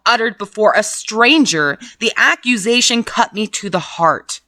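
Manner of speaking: usual speaking voice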